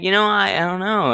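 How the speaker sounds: lying Cain. Retold or imitated. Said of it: "He was just like".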